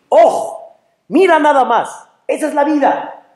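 high, elated exclamation; marvelling. Acted. Person moved by the Creator's nearness, marvelling. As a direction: exclaim in wonder at life. Acting out the feeling of wonder.

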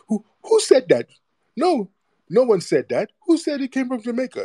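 very high squeaky put-on voice; mock playing dumb. Host imitating commenters with sudden amnesia, mock high-pitched denial. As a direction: feign ignorance in a high, mocking squeal.

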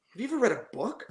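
condescending put-down. Retold or imitated. Producer mocks the cocky young artist, scornful. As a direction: mimic him sneering at the young singer. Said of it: "And he said it like that".

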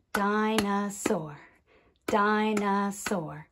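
"Dinosaur" in three even beats; clapped syllables. Shown as a demonstration. Teacher clapping with children, "Dinosaur" split into syllables. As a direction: clap out "Dinosaur" as di, no, saur. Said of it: "Let's clap it".

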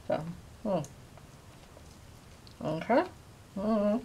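talking while biting food; chewing between words; mouth full of jerky. Real, not acted. Seen 4: Mouth clamped on jerky strip throughout, then chewing afterward.

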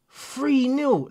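can't believe the score, ask it high; disbelief. Real, not acted. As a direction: pitch jumps up in shock. Shocked at football score.

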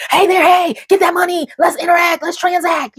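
high, loud mock pitchman; mocking hustle voice. Mocking online chatter about money, high and loud. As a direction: parody a money-hungry hustler calling out. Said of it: "We only focus on".

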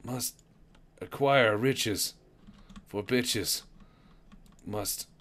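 halting, one word at a time with pauses; gasping slow talk. Pretend. Gasping player short of oxygen, talking slow and halting. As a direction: play out of air, slow and broken. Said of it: "talk slow".

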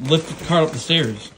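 ordinary talk

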